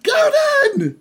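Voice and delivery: very high, drawn-out cries of names; squealed long-distance greeting; overjoyed calling. Acted. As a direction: holler joyfully to friends far off. Character screams friends' names across distance.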